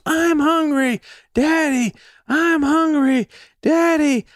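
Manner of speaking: put-on high, loud child's whine; dad imitating his son whining for food; whiny little kid. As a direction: whimper like a hungry four-year-old. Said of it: "He's saying all this stuff".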